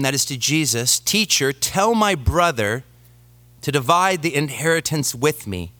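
scripture reading. Acted. Pastor reads Luke aloud.

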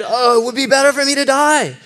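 high, hammed-up sulky wail; mock-dramatic Jonah; whiny Jonah. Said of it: "And he said".

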